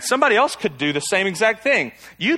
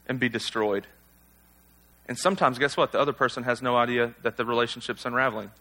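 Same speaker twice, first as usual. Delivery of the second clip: ordinary talk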